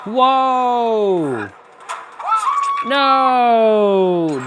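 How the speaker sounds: loud startled yell; startled shout. Real, not acted. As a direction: shout it in sudden alarm. Gamer startled by enemy.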